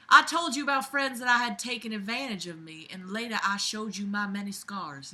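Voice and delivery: put-on Southern belle accent; [name], Southern belle. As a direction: read the letter as a Southern belle. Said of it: "my Southern Belle accent".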